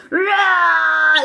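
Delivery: loud, high, raw roar; primal cavewoman roar. Retold or imitated. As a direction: let out a primal birthing yell. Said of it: "like, cave woman".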